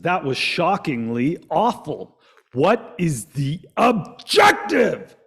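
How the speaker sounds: loud, slow, exasperated movie-star delivery; Will Smith impersonation. Retold or imitated. Host doing his Will Smith for the audience, slow and loud. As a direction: drag it out and punch objective like Will Smith in Hitch. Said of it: "How's my Will Smith impersonation?".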